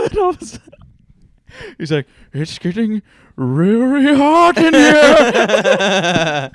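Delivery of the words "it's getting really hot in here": high, loud, slow, laughing moan; hammed-up overheated man, laughing through it; mock overheated moan. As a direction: whine it out like you're melting.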